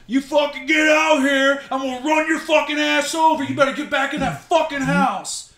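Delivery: retold yelling. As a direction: bark the threat at him, half acted. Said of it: "I'm yelling at him".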